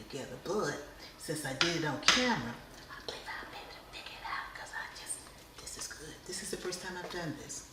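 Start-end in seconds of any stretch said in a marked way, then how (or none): 2.9-6.1 quick breathy whisper of delight. Real, not acted. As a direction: hush it fast, almost no voice. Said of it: "Every time I start whispering".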